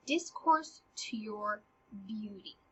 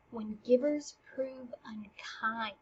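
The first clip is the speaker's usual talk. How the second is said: whispered; 2 semitones lower, 7 dB quieter, slower than usual. Acted. Reciting Ophelia's rebuke.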